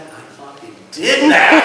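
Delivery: loud, high, drawn-out triumphant yell; triumphant outburst. Real, not acted. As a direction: burst out with pride, high and loud. Proud boast, bursting out.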